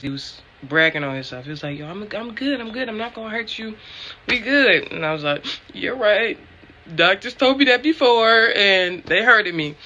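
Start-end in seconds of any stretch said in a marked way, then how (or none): 1.8-4.9 higher-pitched imitation of a breezy dentist; cocky dentist's reassurance. Dentist reassuring a nervous patient, mock-confident. 5.7-9.7 retold protest. Retelling her protest to dentist.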